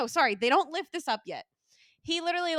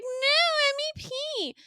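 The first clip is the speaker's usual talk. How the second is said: mock insistence; 14 semitones higher, faster than usual. She argues with him over paying, mock insistence. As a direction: imitate her insisting, much higher. Retold drunk insistence on flight.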